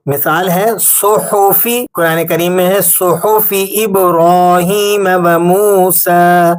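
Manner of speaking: صحفی drawn out slowly with a thick ص; heavy saad recitation. Shown as a demonstration. Teacher reciting صحفی ابراہیم و موسیٰ, slow and heavy. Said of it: "ایک اور مثال دیکھیے گا".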